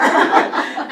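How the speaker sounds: bursting into loud breathy laughter; real laugh at the memory. Real, not acted. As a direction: let a big laugh out. Laughing at own story.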